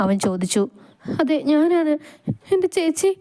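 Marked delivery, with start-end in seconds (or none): 1.2-3.1 high, quavering tearful answer. Acted. Girl asks hospital man about her injured sister, tearful. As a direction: plead through acted tears.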